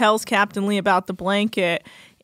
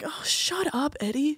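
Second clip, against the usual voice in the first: soft, higher scoff; dismissive; 6 semitones higher, 8 dB quieter than usual. Retold or imitated. To Eddie, softer dismissive scoff. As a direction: brush him off under your breath. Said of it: "And I'm like".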